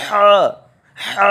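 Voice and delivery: "ہا" as a thick Arabic ha from the throat; thickened throat sound. Shown as a demonstration. Teacher demonstrates thicker sound, throaty "ہا". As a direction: squeeze a strong breathy h from the throat, then long "ہا". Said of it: "تھوڑی آواز گاڑی کر کے نکالیں گے".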